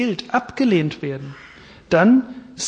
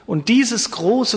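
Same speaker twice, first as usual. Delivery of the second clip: normal talking voice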